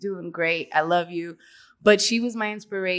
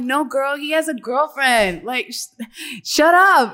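raised, louder mock exasperation; exasperated retort; 6 semitones higher, 5 dB louder, slower than usual. Retelling her reply to a smitten friend, mock exasperated. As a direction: shut her down, playfully exasperated.

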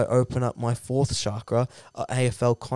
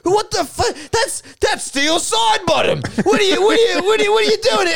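very high, shouted disbelief; shocked outburst; 4 dB louder than usual. Acted. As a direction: sputter in hammed-up alarm. Acted shock at intruder.

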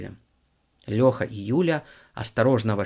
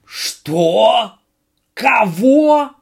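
shocked yell; 12 semitones higher, 11 dB louder, much slower than usual. Acted. Director hears bad news.

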